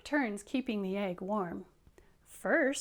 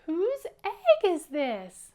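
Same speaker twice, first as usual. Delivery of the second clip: put-on loud storybook shout for children to copy; calling out; 8 semitones higher, 10 dB louder, slower than usual. Shown as a demonstration. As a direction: demonstrate a high, loud, slow call. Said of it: "Can you do it like him?".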